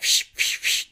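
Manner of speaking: sharp hissed "fsh" bursts; imitated speaker noise. Storyteller imitating the speakers' sudden noise, static bursts. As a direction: mimic static bursting from a loudspeaker. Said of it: "made that noise".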